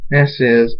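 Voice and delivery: ordinary delivery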